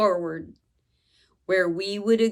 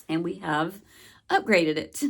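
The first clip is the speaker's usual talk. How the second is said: ordinary talk, nothing marked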